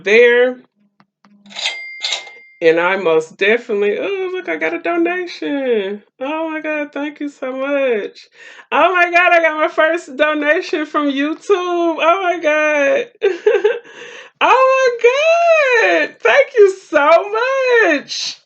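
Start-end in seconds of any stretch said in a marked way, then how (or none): 4.0-11.4 high, breathless squeal of joy; excited squeal. Real, not acted. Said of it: "so excited right now". 11.5-18.4 very high, tearful laughing cry; overwhelmed, half laughing, half crying; laughing through tears. Real, not acted. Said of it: "You gonna make me cry".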